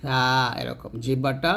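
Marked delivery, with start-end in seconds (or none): 0.0-0.2 lisped th sound in সা, tongue at upper tooth tips; নরম সা. Shown as a demonstration. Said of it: "বলতে হবে সা". (সা উপরের দাঁতের আগায়, নরম করে)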